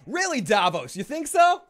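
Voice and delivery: high, mocking "really, you think so"; sarcastic. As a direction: tease Davos for stating the obvious. Sarcastic reply to Davos.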